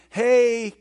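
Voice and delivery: loud, breezy, offhand tone; mock casual suggestion. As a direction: play it like a friendly shout-out. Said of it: "He's not saying".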